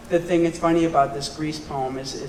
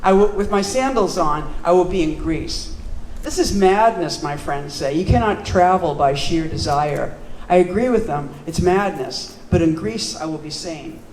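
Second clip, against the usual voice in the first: louder, declaimed poem with measured phrasing; poetry reading; 3 semitones higher, 7 dB louder than usual. Acted. Poet reading an early poem to an audience, declamatory. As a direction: project each line firmly, letting the endings fall. Reads own poem aloud.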